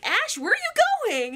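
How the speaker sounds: high, drawn-out call after him; Misty. Acted. Girl calling after the fleeing boy, high and insistent.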